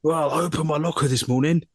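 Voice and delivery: lightly voiced coworker, insisting he's been wronged; indignant coworker. Coworker complaining to HR about his locker, mildly indignant. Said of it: "He goes".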